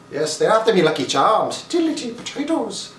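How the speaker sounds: cartoonish Irish accent, leaping high at the end. Shown as a demonstration. Vlogger tossing out Irish catchphrases, hammed-up Irish accent. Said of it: "This is a bit of the Irish accent".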